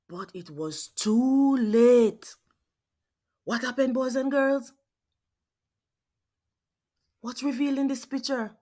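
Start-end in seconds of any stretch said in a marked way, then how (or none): none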